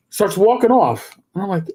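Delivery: ordinary talk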